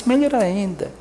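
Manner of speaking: ordinary delivery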